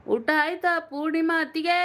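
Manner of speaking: high sing-out call. Real, not acted. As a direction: holler a greeting question to sister-in-law. Calling out to a viewer.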